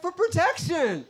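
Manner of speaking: high-pitched, drawn-out wail; defensive girlfriend. Retold or imitated. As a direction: imitate a girlfriend protesting in a high voice. Acted girlfriend's defensive answer.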